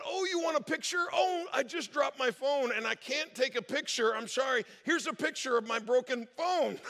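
high, hammed-up innocent excuses; playing dumb. Staffer stalls a scammer with excuses, mock innocent. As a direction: act helplessly apologetic, laying it on thick. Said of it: "he decided to have some fun with it".

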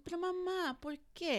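put-on high, soft child's Spanish plea; small questioning voice, as a young son; imitated little boy. Coworker's son questioning his mom, imitated high soft child. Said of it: "tells me the way that he talks".

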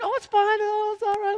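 high-pitched imitation of a reassuring mom; mom's reassurance. Mom excusing the banging dryer, imitated high reassurance. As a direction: put on a high voice to wave it away. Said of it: "she's just like".

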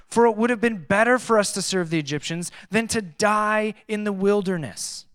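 high, loud, desperate accusing cry; frightened crowd blaming their leader; panicked complaint. Acted. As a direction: wail it at Moses as a terrified crowd. Israelites cry out to Moses.

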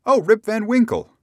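high, loud exclamation; exclaiming. A narrator's reading. Bystanders answer Rip's question, high exclamation. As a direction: pipe up loudly in recognition. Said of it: "exclaimed two or three".

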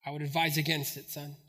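low, quiet fatherly warning; understated, deep dad voice advising against it; retold cautioning father. Father warns his son off the creek jump, low and measured.

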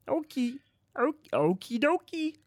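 frog voice. Acted. Frog character agrees to leave.

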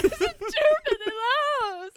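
high, loud, laughing sob of a line; half crying, half laughing panic; sobbing while laughing. Retold or imitated. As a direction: gasp it out between sobs and giggles. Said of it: "I'm, like, sobbing".

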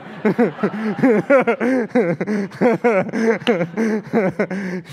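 a long real laugh at his own joke; laughing after teasing his wife; real laughter. Real, not acted. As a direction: laugh it off for a few seconds.

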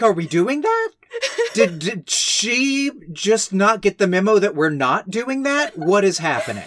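mock disbelief. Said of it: "I was like".

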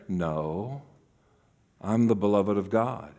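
low, soft, steady answer; quiet self-reminder. Acted. Jesus reminding himself who he is, quiet and low. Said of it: "he would have to remember".

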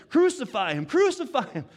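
loud, rising mob cry; crowd shouting. Acted. Crowd demanding crucifixion, loud angry shout.

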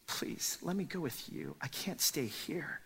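pleading whisper. Acted. The man pleading to leave.

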